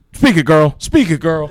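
mild mock cheer from the crowd; imitating a cheering backer. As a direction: egg her on like a hype man, lightly. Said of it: "Al Sharpton or whoever's in the background".

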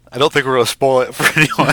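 laughing through the joke. Real, not acted. Joking that nothing can be spoiled.

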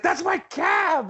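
high, drawn-out cry after a cab; calling out. Acted. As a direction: yell it in protest, stretched out. Shouting after stolen cab.

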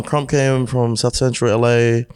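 ordinary talk

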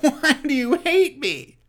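high, whining complaint; whiny Phillip Rivers. Retold or imitated. Phillip Rivers whining at his linemen, high whiny voice. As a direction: whine it up high like a sulking quarterback. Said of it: "Looking at the referees, like".

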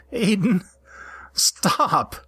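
high, laughing plea to stop; giggling protest. Acted. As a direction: squeal it through giggles. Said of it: "laughing out loud and squirming".

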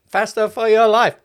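high, loud mock coach yell; yelled movie quote. Quoting a coach, high mock yell. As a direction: bark it like a gym coach. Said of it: "what I remember from that".

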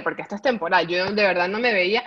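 usual speaking voice

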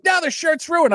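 high petulant complaint; a hint of a sulking cousin; cousin whining. Cousin complaining about his shirt, mock whine. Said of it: "So he's like".

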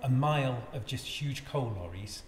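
ordinary talk, nothing marked

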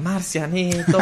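high, drawn-out mock cheer; imitating children calling. Children calling to their favourite wrestler, high mock cheer. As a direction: call it out like an excited young fan, without full volume. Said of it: "escucho como los niños gritan".

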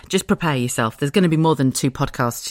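ordinary talk

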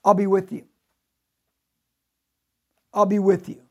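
emphatic repeat. Shown as a demonstration. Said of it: "what did he just tell Gideon".

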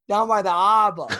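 cartoon Boston accent, no H or R; imitated Boston cabbie. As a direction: call out "harbor" as Boston "ah-bah". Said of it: "And then the word harbor".

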